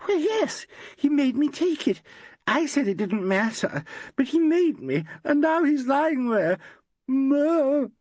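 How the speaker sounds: high, slow, teary voice; a distraught actress explaining herself; tearful young woman. A narrator's reading. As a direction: gush out the worry near tears. Valerie breaking down.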